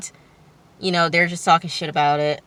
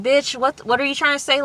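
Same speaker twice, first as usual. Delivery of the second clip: high-pitched mock indignation; confrontational challenge; 8 semitones higher than usual. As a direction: act out calling someone out, voice pitched up. Imagined confrontation as Jessica.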